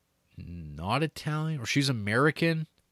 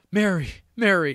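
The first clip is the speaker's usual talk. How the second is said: higher, louder mock call for Mary; 8 semitones higher, 6 dB louder than usual. Giovanni chasing Mary, calling out. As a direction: call after her across the platform. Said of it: "he's like".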